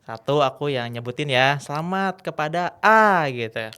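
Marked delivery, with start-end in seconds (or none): 1.6-3.2 stretched, higher, louder announcer call; MC winner announcement. Retold or imitated. MC congratulates a winner before the audience, announcer call. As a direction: announce the winner with a drawn-out name.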